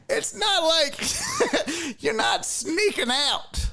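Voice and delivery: high-pitched, words broken by real laughter; laughing while talking. Real, not acted. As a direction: struggle to talk through a laughing fit. Laughing through own story.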